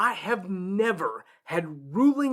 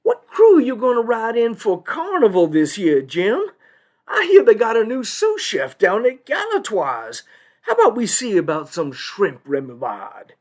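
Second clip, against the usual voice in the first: higher, louder Southern judge voice; chummy small talk from a good old boy; socializing good-old-boy judge; 6 semitones higher, 4 dB louder than usual. Acted. Judge socializes with Avondale's lawyer before court, in chummy good-old-boy voice. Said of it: "he's socializing with Avondale's lawyer".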